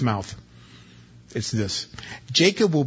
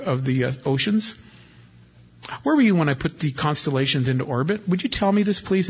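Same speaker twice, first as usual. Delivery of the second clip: sarcastic God; 4 semitones higher than usual. Acted. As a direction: needle Job with sarcastic questions. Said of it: "God gets very sarcastic with Job".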